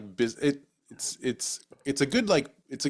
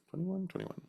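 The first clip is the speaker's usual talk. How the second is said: high squeaky repeat; 8 semitones higher, 12 dB quieter than usual. Shown as a demonstration. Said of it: "repeated twenty-one".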